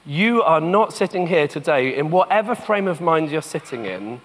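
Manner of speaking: gentle searching sermon voice with long pauses. Acted. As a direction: let each phrase sink in, hushed.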